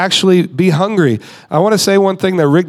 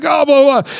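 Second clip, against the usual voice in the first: high-pitched mocking babble; mock charismatic preacher; 9 semitones higher than usual. Mocking charismatic church pressure, high hammed-up babble. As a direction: parody pushy preaching in a high voice. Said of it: "especially in the charismatic church".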